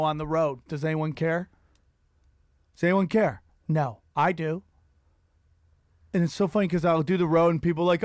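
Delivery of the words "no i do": ordinary delivery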